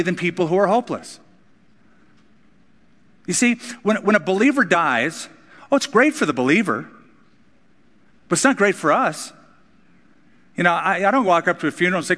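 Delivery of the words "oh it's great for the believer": usual speaking voice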